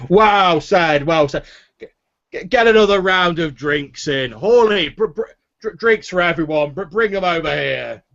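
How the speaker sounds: loud, high, stammering drinker ordering a round; rowdy storyteller calling for drinks; boisterous tavern drinker. Acted. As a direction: cheer the table on loudly, stumbling over words. Game master voices boisterous Haller.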